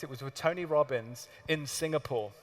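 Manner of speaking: ordinary delivery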